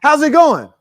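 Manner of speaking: bright greeting. Acted. Acted greeting again.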